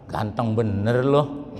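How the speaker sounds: drawn-out fake flattery; mock praise. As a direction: praise him with a sneer underneath. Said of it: "Itu bukan muji tuh".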